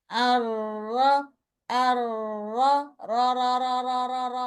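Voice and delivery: level repeated র with motionless lips; still lips. Shown as a demonstration. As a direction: say আর র without moving the lips at all. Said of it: "একদম ঠোঁট নড়াছাড়া বলবেন". (ঠোঁট না নাড়িয়ে র)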